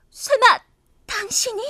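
jumps up in alarm; sudden disbelief, voice leaping high; shocked gasp. Acted. Shocked realization it's him.

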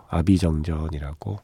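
usual speaking voice

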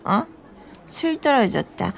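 normal talking voice

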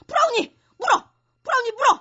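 high, loud order to a dog; frantic attack command. Acted. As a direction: sic the dog on the intruder. Mistress sets dog on intruder.

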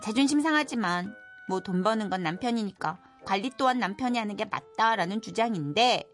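ordinary talk, nothing marked

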